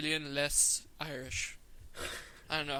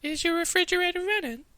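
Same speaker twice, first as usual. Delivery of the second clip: loud, very high falsetto; squeaky pop-star prank caller; 13 semitones higher, 5 dB louder than usual. Acted. Prank caller asking about the fridge, high falsetto star impression. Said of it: "I'm doing [name]".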